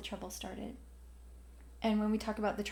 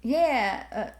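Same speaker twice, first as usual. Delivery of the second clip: imitated Southern local; 7 dB louder than usual. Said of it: "where I'm living, they'll be like".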